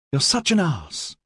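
high, exasperated rebuke; snapped. A narrator's reading. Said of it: "Kanzine snapped at Maxon".

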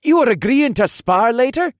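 high, loud, cheery question; cheerful. A narrator's reading. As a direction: check eagerly and brightly. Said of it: "in a cheerful voice".

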